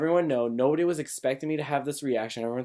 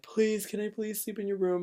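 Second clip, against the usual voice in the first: put-on high, pleading kid's plea; tearful begging; 10 semitones higher, 4 dB quieter than usual. Scared kid begging his brother to share a room, high and pleading. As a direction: beg like a scared little kid. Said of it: "I was like".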